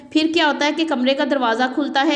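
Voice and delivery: ordinary delivery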